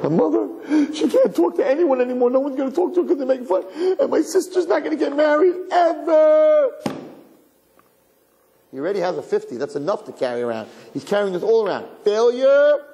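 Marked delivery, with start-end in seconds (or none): 0.0-6.7 high, loud, mock sob of a panicked kid; mock wailing child. Fifth-grader imagining his family ruined, hammed-up wailing. 12.0-12.8 high, loud single word; mock cry of failure. Child branding himself a failure, high and loud.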